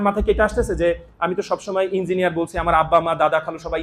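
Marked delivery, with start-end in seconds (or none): none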